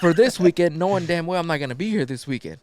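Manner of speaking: loud, high acted outrage; angry complaint. Wife rants to husband about wrong weekend, angry. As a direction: hit it hard, furious at the mistake.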